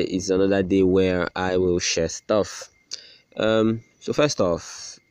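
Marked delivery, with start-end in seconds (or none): none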